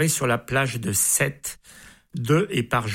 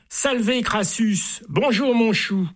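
high bantering call across the arena; high, cheeky crowd shout; 8 semitones higher, slower than usual. Acted. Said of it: "on s'égosille".

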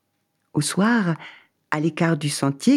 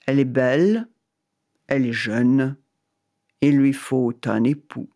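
voice of God; 3 semitones lower than usual. Acted. God answers.

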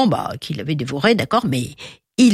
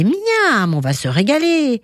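miam haut et chantant; régale-toi d'avance, voix perchée; gourmand; 8 semitones higher than usual. A narrator's reading. Vulture relishing food in letter. (vautour gourmand)

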